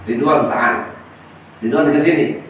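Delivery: lordly summons to the angel; commanding call. Acted. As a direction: call the angel over with authority. God summons angel Ridwan.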